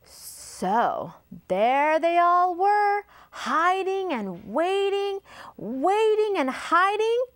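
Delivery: slow, high, drawn-out storytelling; suspense narration. A narrator's reading. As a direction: stretch the waiting out for young listeners.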